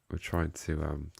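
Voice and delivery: ordinary talk, nothing marked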